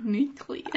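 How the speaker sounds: quiet, faltering try at "Nucleus"; hesitant, botched "Nucleus"; mispronounced nucleus. Real, not acted. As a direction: say "Nucleus" softly, stumbling on the middle. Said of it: "I can't even say it".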